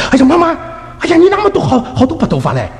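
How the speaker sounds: high, startled cry to mama; high-pitched imitated surprise; retold son's exclamation.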